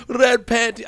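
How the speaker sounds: put-on high-pitched, botched Irish accent; attempted Irish accent. Co-host riffing on a clip, attempted Irish accent. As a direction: have a shaky go at an Irish accent. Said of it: "I can't do the Ir-, Irish accent".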